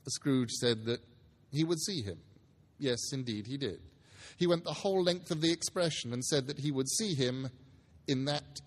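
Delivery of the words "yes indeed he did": usual speaking voice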